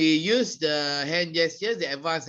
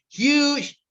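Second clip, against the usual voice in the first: "huge" pitched up high; emphatic descriptive word; 9 semitones higher, 4 dB louder than usual. Shown as a demonstration. Trainer listing descriptive words, emphatic "huge". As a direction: make "huge" big and high.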